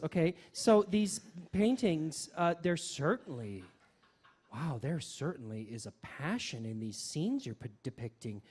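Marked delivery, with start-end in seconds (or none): none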